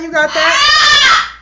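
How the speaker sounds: high, slow, drawn-out yell; shouting. Real, not acted. Yelling at a bird.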